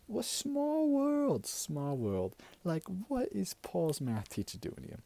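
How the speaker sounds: high, drawn-out amazed gasp; surprised. Retold or imitated. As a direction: stretch out small in disbelief. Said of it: "And I'm like".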